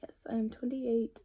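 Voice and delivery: ordinary talk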